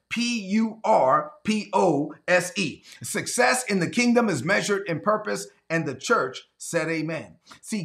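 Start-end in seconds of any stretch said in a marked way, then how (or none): 0.1-2.8 P U R P O S E spoken one letter at a time; spelled out. Shown as a demonstration. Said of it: "How do you spell success".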